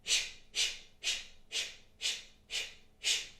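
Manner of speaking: unvoiced rhythmic sh bursts through the teeth; sharp sh breath pumps. Shown as a demonstration. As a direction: pump short hissed sh breaths. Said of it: "So it's like a sh sound".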